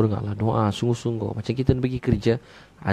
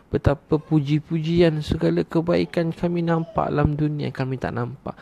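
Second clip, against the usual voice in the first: raised-pitch praise, slow at the start; fervent prayer; 6 semitones higher than usual. Shown as a demonstration. Preacher addresses Allah in praise, fervent and high. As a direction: pray it out with pitch held high.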